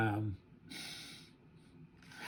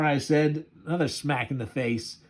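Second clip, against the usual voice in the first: ordinary delivery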